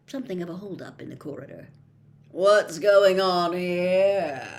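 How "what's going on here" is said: slow, cold, sneering drawl. A narrator's reading. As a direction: drawl it down at them. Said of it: "came the cold, drawling voice of Draco Malfoy".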